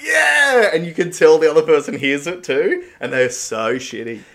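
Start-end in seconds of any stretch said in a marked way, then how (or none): 0.0-0.7 high, loud gleeful yell; triumphant yell. Retold or imitated. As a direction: shout it out in triumph. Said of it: "And you're like".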